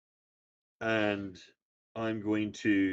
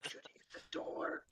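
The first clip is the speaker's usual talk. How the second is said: whisper; 14 dB quieter than usual. Acted. Whispered aside.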